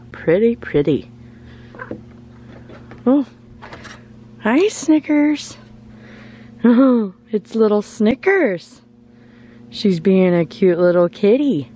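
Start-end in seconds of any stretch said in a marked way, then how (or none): 3.0-8.8 sweet high cat-greeting voice; pet talk. Real, not acted. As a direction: coo a greeting to the kitty.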